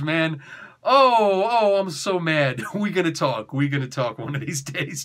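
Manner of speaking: ordinary delivery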